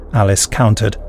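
plain reading voice

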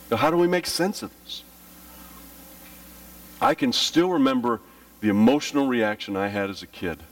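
normal talking voice

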